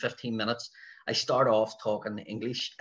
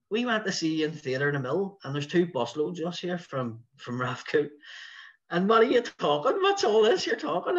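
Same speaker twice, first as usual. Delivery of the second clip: raised, higher voice building to a yell; imitated heckler's shout; 7 semitones higher, 4 dB louder than usual. Heckler to comedian about the Irish, imitated shouting. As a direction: mimic an indignant heckler, climbing in pitch and volume. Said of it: "somebody shouted".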